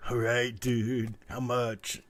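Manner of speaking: slow, laid-back dude voice; slow stoner voice. Retold or imitated. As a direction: drawl it like a sleepy dude. Taco guy answering at window.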